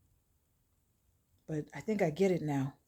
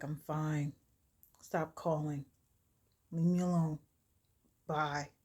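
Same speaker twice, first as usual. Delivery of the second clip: soft, withdrawn brush-off; checked out; 3 semitones lower, 6 dB quieter than usual. Retold or imitated. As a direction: dismiss the caller with no energy. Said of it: "She was totally checked out".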